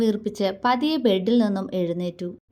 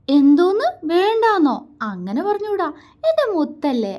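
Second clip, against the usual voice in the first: put-on much higher, louder babyish cooing; 8 semitones higher, 4 dB louder than usual. A narrator's reading. Husband cooing to coax his shy wife. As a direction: coax her like a pet. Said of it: "അവൻ കൊഞ്ചി".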